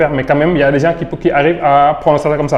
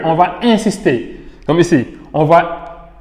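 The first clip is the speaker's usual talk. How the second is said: usual speaking voice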